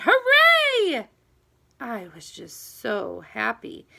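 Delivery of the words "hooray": a big high joyful shout; shouted hooray. A narrator's reading. Girl leaps from her seat at the bell, shouting. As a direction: yell it out as the bell rings. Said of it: "seat and shouted".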